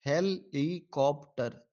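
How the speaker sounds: हेलीकॉप्टर slowly split "hel / i / kop / ter"; syllable division. Shown as a demonstration. Teacher answering a syllable question, हेलीकॉप्टर broken slowly into hel-i-kop-ter. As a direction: sound out हेलीकॉप्टर in four separate beats. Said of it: "Syllable division of the word helicopter".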